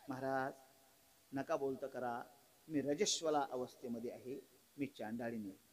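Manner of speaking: a hint of a modest woman keeping her distance; meek woman's voice.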